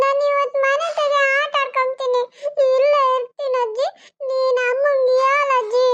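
high, slow, put-on tearful whimper; fake crying child. Little boy fake-crying to grandma to stay home. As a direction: whimper like a boy faking tears. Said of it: "ಏನ್ ನಾಟಕ ಆಡ್ತೀಯ?".

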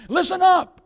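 high, loud command; call out. Real, not acted. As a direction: bark it to wake the room. Preacher calling out.

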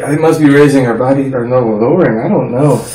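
usual speaking voice

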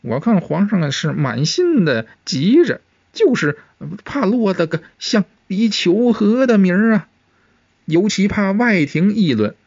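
lightly mumbled warning, pitched high; muttering eunuch. A narrator's reading. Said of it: "高启乾咕囔地说道".

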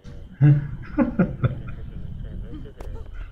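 breathy high-pitched laugh. Real, not acted. Laughing at game prank.

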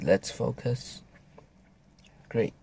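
ordinary talk, nothing marked

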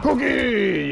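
high mock cracked cry; imitating voice crack. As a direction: replay your own cracked yell, lightly exaggerated. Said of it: "I had my voice cracked there".

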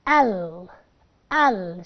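low, falling "ال" with a wide vowel; open-mouthed L. Shown as a demonstration. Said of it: "شايفين وانا بفتح بقي ازاي".

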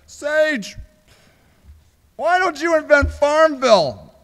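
loud, high-pitched mock mom voice; imitating mother. Mother pushing Sage to invent Farmville, imitated loudly. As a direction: call it out high and loud, like a nagging suggestion. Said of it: "And she said".

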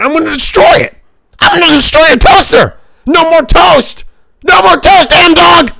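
high, loud, ranting threat; mock-furious shouting. Streamer threatening to destroy a chatter's toaster, furious mock shout. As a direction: scream the threat over the top.

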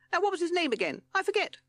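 very high, loud bat voice; squeaky bat. A narrator's reading. Bat reminding Rat of a meeting, squeaky.

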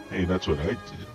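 ordinary talk, nothing marked

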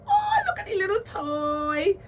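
high gushing coo; delighted squeal. Real, not acted. Spotting the doll's little toy, squealing delight. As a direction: squeal over something tiny and cute.

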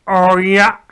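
loud startled exclamation. Acted. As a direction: blurt out in surprise. Queen reacts to giant news.

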